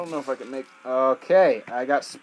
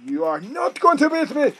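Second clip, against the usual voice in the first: high, loud challenge to the game; defiant shout; 11 semitones higher, 5 dB louder than usual. Real, not acted. Gamer shouting at the very hard level, defiant.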